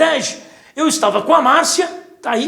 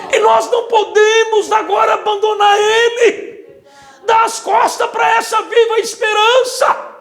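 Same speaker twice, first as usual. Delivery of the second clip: high, loud, fervent sermon climax; preaching at full force; 8 semitones higher, 3 dB louder than usual. Acted. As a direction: rise into a passionate shout to the church.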